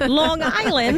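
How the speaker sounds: put-on drawn-out, high New York accent on Long Island; slow, teasing New York accent; playful mock New York accent. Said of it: "trying to do my New York accent".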